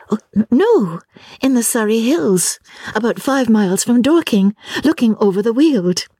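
high, light voice correcting politely; earnest young lady. Acted. Lucy answers.

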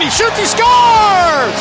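drawn-out high shouted goal call. Real, not acted. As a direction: belt out the goal slowly.

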